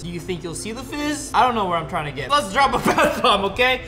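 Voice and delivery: usual speaking voice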